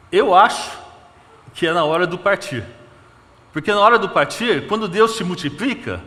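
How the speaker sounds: full-force preaching. Acted. Pastor climaxing his sermon on being broken and multiplied, loud fervent preaching. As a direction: preach it out with fire.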